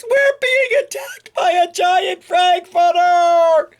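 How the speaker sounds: high, loud, frantic cry; panicked shout. Acted. Fleeing cats shrieking about the frankfurter, high and loud. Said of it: "cried the cats".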